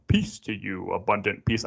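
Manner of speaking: a hint of a stately king; lightly voiced royal decree; slight royal pronouncement; regal king voice. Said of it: "I don't know why I started talking like that".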